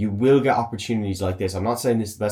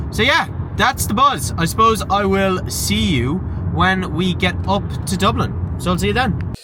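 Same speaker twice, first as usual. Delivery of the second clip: high sign-off voice; 9 semitones higher, 3 dB louder than usual. Acted. Cheery sign-off in put-on voice.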